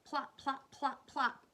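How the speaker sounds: high, popping sound-effect words; squelching noises. A narrator's reading. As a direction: punch out each plop as an impact. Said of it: "horrible squelching noises".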